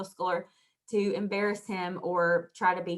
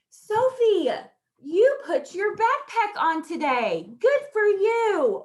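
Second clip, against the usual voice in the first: put-on high, loud, slow cheer to a child; teacher cheering a child who managed her backpack; enthusiastic praise; 9 semitones higher, 6 dB louder, slower than usual. Shown as a demonstration. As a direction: call her name bright and proud. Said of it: "she just needs to be encouraged".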